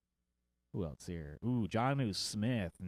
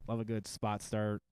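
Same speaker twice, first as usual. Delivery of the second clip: ordinary delivery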